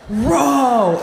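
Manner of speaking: sudden high shouted command; a dad yelling one word; dad's yell. Retold or imitated. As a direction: bark the order at the kids. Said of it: "my dad just looks at us and he goes".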